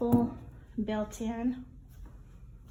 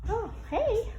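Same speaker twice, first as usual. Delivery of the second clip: sudden high startled oh hey; quick surprise into a laugh; surprised; 10 semitones higher than usual. Real, not acted. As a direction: caught off guard, then a breathy laugh. Surprised by something in room.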